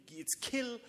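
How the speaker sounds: normal talking voice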